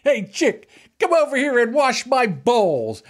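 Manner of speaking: high-pitched hammed-up golfer's come-on; mock catcall. Golfer hollering at a woman to come over, mock catcall. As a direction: call out a cheesy innuendo, high and playful.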